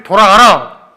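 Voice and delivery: God ordering Jacob home, loud; commanding God. Acted. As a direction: boom the command.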